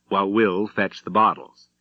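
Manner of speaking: plain reading voice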